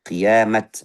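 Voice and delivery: usual speaking voice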